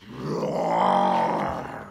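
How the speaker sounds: very low roar; deep lion roar. Acted. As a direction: growl it like a lion. Lion roars.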